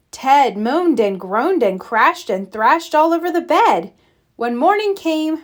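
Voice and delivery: ordinary delivery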